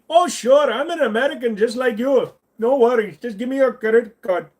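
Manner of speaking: put-on indignant Indian accent, high and loud; Indian accent scammer. Streamer mocking a phone scammer, high loud Indian accent.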